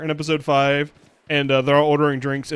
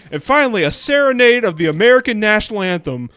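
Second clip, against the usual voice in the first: stadium announcer; 4 semitones higher, 8 dB louder than usual. Retold or imitated.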